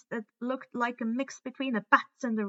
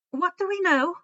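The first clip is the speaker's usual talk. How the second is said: stern; 7 semitones higher, 6 dB louder than usual. A narrator's reading. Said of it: "Kara immediately asked sternly".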